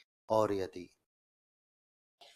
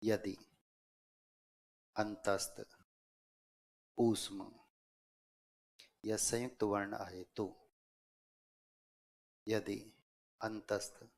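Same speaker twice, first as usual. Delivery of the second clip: broken into short chunks with long pauses; dictation. Real, not acted. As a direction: dictate phrase by phrase, waiting for writers. Dictates a rule for writing. (श्रुतलेख, रुक-रुककर बोलते हुए)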